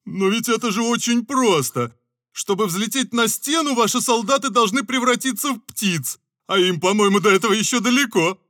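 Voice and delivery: playful mockery. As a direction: spell out the joke. Scarecrow mocks. (насмешливо)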